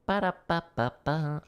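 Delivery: high, slow "ba-da-ba" scatting; vocalized drum rhythm. Real, not acted. Drummer about to show a pattern, vocalized rhythm. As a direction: sound out a drum lick with the voice.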